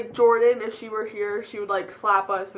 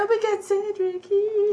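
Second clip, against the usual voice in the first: high-pitched mock fangirl squeal; swooning fangirls; 10 semitones higher, 4 dB louder than usual. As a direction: imitate girls swooning over their crush, pitched way up. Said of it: "all the girls are like".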